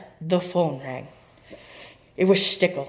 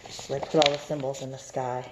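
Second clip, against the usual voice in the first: ordinary delivery, nothing marked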